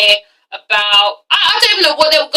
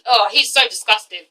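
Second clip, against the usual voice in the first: normal talking voice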